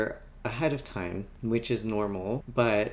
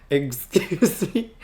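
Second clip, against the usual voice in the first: disbelief; 5 semitones higher, 4 dB louder, much slower than usual. Retold or imitated. Retold disbelief at her tabs.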